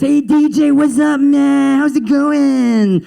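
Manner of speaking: high, stretched-out fake greeting; mocking corridor stranger. Strangers mocking his accent in corridors, high and drawn-out. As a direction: jeer with drawled words, sustained high pitch.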